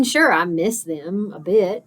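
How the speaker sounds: usual speaking voice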